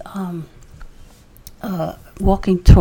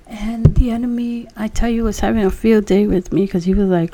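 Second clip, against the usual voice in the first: normal talking voice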